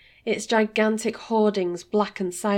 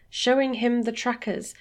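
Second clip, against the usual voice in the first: plain reading voice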